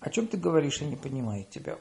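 ordinary talk, nothing marked

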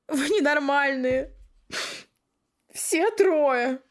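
high loud teary outburst; tearful laughing exclaim. Acted. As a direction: cry it out, laughing through tears. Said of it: "проговорила я, стряхивая слёзы".

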